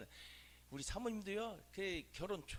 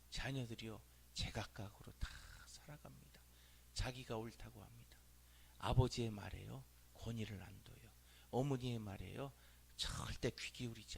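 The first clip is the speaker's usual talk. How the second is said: whisper; 8 semitones lower, 8 dB quieter than usual. Real, not acted. Preacher drops to a whisper.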